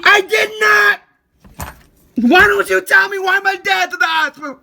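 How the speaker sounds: real heated outburst; angry shouting. Real, not acted. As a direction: shout for an answer, at the end of patience. Shouting at relative in quarrel.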